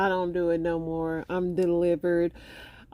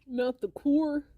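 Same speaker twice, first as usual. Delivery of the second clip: put-on California accent; 9 semitones higher than usual. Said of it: "California accents are very funny".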